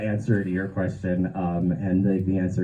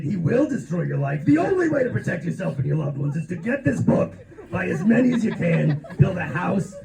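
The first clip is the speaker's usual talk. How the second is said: Grunkle Stan huckster; 11 semitones higher than usual. Pretend. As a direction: hawk it like a sleazy carnival barker, laughing. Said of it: "could you push this book like it was Grunkle Stan just being a huckster".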